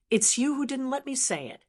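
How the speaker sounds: plain reading voice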